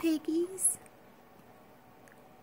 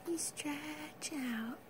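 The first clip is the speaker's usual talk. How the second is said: slow, softer whisper; drawn-out tender whisper; 6 dB quieter, much slower than usual. Real, not acted. Mother watching baby stretch.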